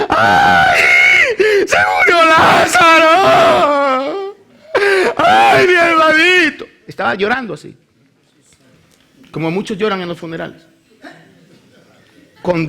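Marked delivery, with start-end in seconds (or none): 0.1-6.7 hammed-up, high-pitched wailing cry; loud funeral wailing. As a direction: wail loudly at a graveside, drawing out the words. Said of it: "María estaba llorando así".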